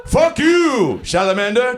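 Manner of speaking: high, loud mock yell; imagined audience member yelling at a salamander, hammed-up shout; pretend shout. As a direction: scream it as a last defiant word. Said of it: "I don't want you going".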